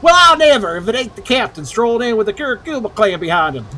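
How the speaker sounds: loud, high southern "well I never"; hammed-up Southern character voice; southern accent. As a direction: read it as an affronted Southerner. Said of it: "I'm gonna use that voice for him now".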